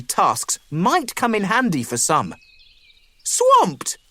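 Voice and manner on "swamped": high, rising surprised question; curious weasel kids. A narrator's reading. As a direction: perk up at the word Swamped. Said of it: "the bored weasels asked".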